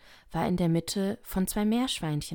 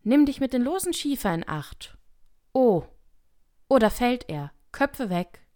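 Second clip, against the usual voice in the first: raised, higher-pitched warning calls; called warning; 7 semitones higher, 7 dB louder than usual. Acted. Workers warn each other about a loose slate, calling out. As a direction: shout out a warning to the crew.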